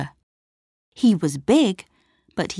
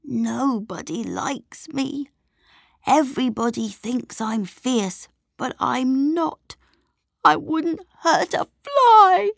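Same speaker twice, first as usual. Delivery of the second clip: sobbing monster; 6 semitones higher than usual. A narrator's reading. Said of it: "he sobbed".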